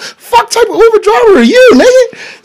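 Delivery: high, loud mock yell at the driver; mock outraged yell. Yelling at Uber driver.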